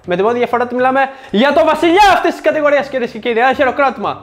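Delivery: high, loud, fast hype introduction; mock grand announcer. Presenter introducing the king of the category, mock grand announcement. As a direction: hype it up like a ringmaster.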